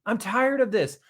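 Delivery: high, loud and slow, fed up; passionate, exasperated outburst. Shown as a demonstration. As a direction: spit it out like you've had enough. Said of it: "from an emotional place of".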